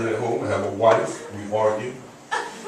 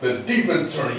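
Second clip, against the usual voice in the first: normal talking voice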